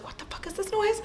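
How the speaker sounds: high-pitched baffled complaint; mock distress. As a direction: act out alarm in a high voice. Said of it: "she's just like".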